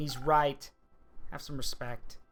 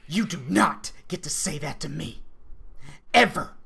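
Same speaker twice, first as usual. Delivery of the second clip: much higher and louder, raging yell; furious shout; 5 semitones higher, 7 dB louder than usual. Acted. Lashing out at the one who blamed her, furious shouting. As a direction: scream it down their throat. Furious reply to cruel remark.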